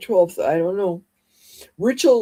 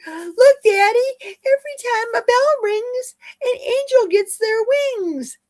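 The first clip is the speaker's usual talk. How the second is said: put-on little girl quote; 3 dB louder than usual. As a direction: play the small daughter tugging at her dad. Quoting the film's little girl.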